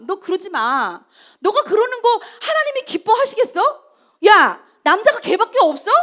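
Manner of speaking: loud, high-pitched retold scolding of a friend; a friend shouting at her to snap out of it; scolding rebuke. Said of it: "제가 직언을 했어요".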